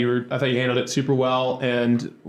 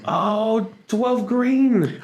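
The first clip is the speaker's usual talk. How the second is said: much higher, mock-impressed New York accent; 9 semitones higher, much slower than usual. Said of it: "this accent too".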